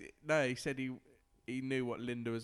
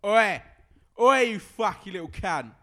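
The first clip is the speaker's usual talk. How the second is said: higher, louder barking "Oi!" and snarled insult; aggressive pub lout; 5 semitones higher, 12 dB louder than usual. Acted. Drunk lout in the pub picking on a stranger, loud barking snarl. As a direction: bellow it like a drunk spoiling for a fight. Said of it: "you gotta have the proper voice".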